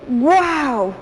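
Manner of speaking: a drawn-out amazed wow; mock amazement. Shown as a demonstration. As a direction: sound genuinely impressed. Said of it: "es una emoción".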